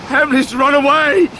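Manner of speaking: pitched high and strained with grief; a heartbroken man barely holding together; distraught. Acted. Ham telling David that Emily has gone, distraught.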